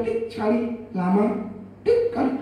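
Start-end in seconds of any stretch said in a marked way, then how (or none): 1.8-2.1 short very high beep; quick high mock beep; monitor beep. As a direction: chirp like a heart monitor.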